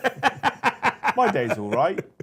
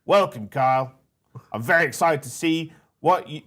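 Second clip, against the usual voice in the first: bad Australian accent; 4 semitones higher, 4 dB louder than usual. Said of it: "sound like a shit accent".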